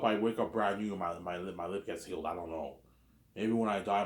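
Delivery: ordinary delivery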